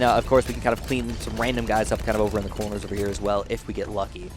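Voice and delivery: ordinary delivery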